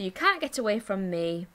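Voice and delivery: normal talking voice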